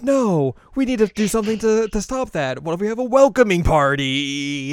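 high, loud, urgent club-member imitation; excited alarm. Club member proposing a welcome party, excited alarm. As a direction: act out panic turning into a bright idea.

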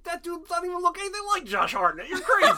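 high, loud, laughing protest; real laughing objection to a friend's comparison; laughing disbelief. Real, not acted.

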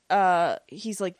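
ordinary talk, nothing marked

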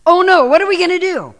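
high, loud alarmed wail; mock panic. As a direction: play the frightened believer. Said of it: "this is my response usually".

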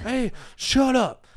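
hammed-up drunk guy telling them off; high mock drunk yell. Imitating drunk baby daddy yelling.

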